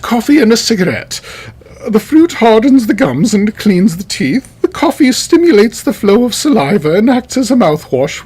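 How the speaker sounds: high-pitched, put-on British official, not quite English; funny English-accent attempt. Quoting a British health official, funny English-accent attempt. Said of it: "That wasn't supposed to be an, an English accent".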